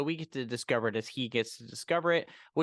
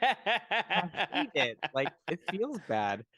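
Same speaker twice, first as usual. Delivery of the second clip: squeamish villain; 5 semitones higher, slower than usual. Retold or imitated. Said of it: "he's like".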